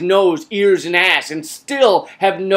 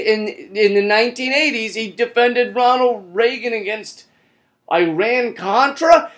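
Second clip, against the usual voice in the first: ordinary talk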